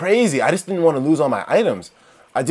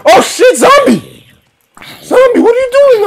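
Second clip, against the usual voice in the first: high, loud startled screaming; real alarm, shout it; panicked shout; 10 dB louder, slower than usual. Real, not acted. Player screams at a zombie on the mountaintop, panicked shout.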